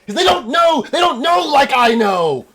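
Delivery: high, loud zealous cry; wailing mad prophet; insane elf. Acted. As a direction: shout it with crazed conviction. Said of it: "fucking insane elf".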